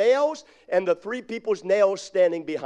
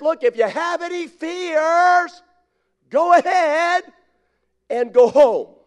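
high, slow, loud command; commanding Gideon; 9 semitones higher, 6 dB louder, slower than usual. Acted. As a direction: call out the order to the army. Gideon addresses the troops.

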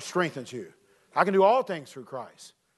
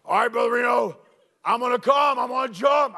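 put-on loud, raised voice of a kid calling down; hollering Johnny; 4 semitones higher, 5 dB louder than usual. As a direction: holler it from the clifftop. Voicing Johnny before his jump.

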